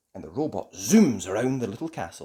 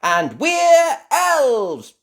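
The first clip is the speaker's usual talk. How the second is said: high, slow, loud proud cheer; 11 dB louder, much slower than usual. Acted. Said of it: "cheer Ben and Barnaby together proudly".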